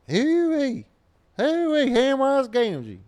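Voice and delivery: put-on very high, loud, slow Oklahoma accent old man; squeaky hollered Oklahoma gaffer call. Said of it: "Oklahoma gaffer".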